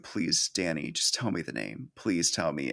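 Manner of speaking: soft, pleading retelling of Helen begging; mock pleading. Helen begs Danny for the name, soft mock plea. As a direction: beg quietly, a touch hammed-up. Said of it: "Helen's final plea is like".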